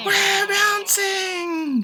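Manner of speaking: very high, slow, stretched cry of delight; gleeful bouncing. A narrator's reading. Shishi bouncing on lily pads, high and drawn out with glee. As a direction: ride each bounce with joy. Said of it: "Shishi laughs".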